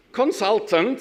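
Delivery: ordinary talk, nothing marked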